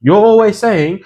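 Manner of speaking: imitated Chinese-accented DVD seller; mock Chinese accent. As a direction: protest in a put-on Chinese accent. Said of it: "like this, it was Chinese accent".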